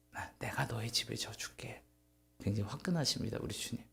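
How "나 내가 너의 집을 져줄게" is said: hushed, intimate whisper of God's promise. Acted. God promises David a house, whispered. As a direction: breathe the promise like a secret.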